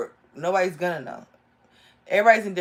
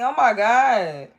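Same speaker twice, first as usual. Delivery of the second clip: excited gasp; 4 semitones higher, 8 dB louder, much slower than usual. Real, not acted. Excited reaction to drawn card.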